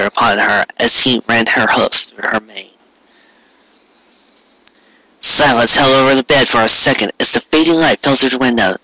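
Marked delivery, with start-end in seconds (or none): none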